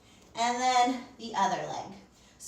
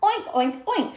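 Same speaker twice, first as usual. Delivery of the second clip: high, loud oinks; playful pig oinks; snorting pig; 7 semitones higher, 4 dB louder than usual. Acted. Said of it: "snorted Pig".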